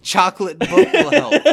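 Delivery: loud, high, laughing mock Michael; imitating Michael. Host imitating Michael recommending a book, laughing loudly. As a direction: ham up the chocolate book line through laughter. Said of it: "the way that he says".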